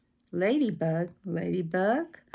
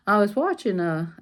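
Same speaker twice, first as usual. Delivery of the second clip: ordinary delivery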